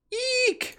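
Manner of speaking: very high-pitched frightened eek; thin, sustained shriek without extra volume; high squeal. Acted. Character screams at scary voice.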